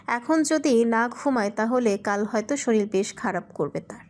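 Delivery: ordinary delivery, nothing marked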